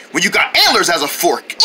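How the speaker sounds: ordinary delivery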